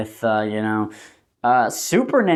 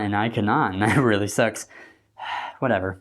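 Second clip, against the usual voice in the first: ordinary talk, nothing marked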